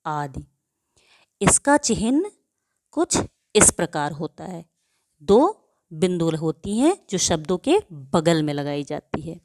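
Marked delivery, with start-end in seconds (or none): none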